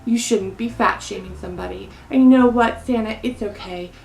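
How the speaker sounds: ordinary talk, nothing marked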